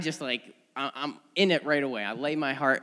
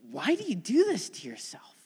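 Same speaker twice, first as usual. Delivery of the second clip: gentle whispered question; 7 semitones higher than usual. Retold or imitated. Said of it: "he's like".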